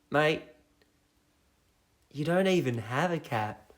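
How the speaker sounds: ordinary delivery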